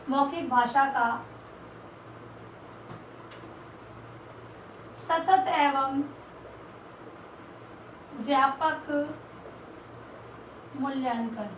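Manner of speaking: very slow, word by word with long pauses; slow dictation. Shown as a demonstration. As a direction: spell out the heading for students to copy. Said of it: "teacher dictating heading slowly". (बहुत धीरे, शब्द-शब्द रुककर लिखवाना)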